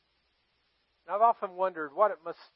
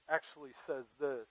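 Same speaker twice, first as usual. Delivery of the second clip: normal talking voice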